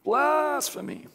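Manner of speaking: high, drawn-out "Blasphemy" in mock horror; hammed-up, slow "Blasphemy" with fake outrage; emphasizing BLASPHEMY. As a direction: stretch the first syllable of "Blasphemy" high. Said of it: "emphasizing blasphemy".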